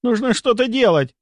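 high-pitched urgent plea; panicky urging. A narrator's reading. A colleague demands they act for the dying patient, panicky. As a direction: push for action, pitch right up.

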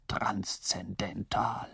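slow whispered "transzendental" fading out. Retold or imitated. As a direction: breathe "transzendental" almost silently. The clever one repeats it.

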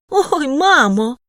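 high, drawn-out slow yelp; pleading cry. A narrator's reading. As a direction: cry out, begging her to stop. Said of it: "благально скрикнув Фарзад".

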